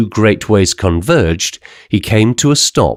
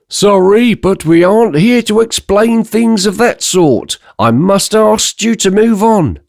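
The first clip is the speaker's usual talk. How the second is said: inspector; 10 semitones higher than usual. Acted. Inspector refusing giant.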